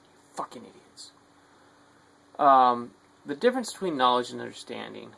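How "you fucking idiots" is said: a soft unvoiced aside; whispered insult. Real, not acted. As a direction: whisper the insult under your breath.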